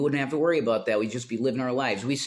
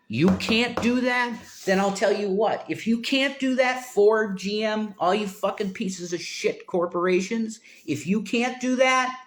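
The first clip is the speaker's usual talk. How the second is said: high, heated, incredulous rant; worked-up challenge; 8 semitones higher than usual. Real, not acted. Streamer challenging Ford and GM over worker pay, indignant. Irate rant addressing car corporations.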